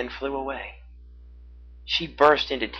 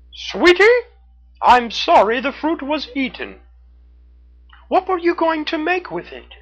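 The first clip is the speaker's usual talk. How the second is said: high, loud, gentle critter voice; consoling raccoon; 7 dB louder than usual. A narrator's reading. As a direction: comfort the crying girl tenderly.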